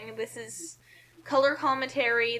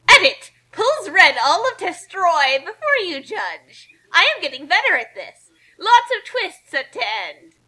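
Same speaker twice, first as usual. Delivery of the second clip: hammed-up author's note; 6 semitones higher, 8 dB louder than usual. Reading author's note in mock voice.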